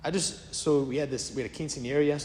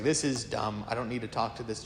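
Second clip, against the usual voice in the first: low, soft, offhand brush-off; dismissive; 5 semitones lower, 6 dB quieter than usual. Retold or imitated. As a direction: brush them off as beneath your notice. King dismisses the girl.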